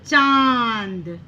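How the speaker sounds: चांद stretched long, nasal vowel; drawn-out nasal pronunciation. Shown as a demonstration. Teacher blending the letters for children, चांद slow and nasal. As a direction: hold the nasal vowel of चांद, then close on "d". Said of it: "तो क्या बन गया ये".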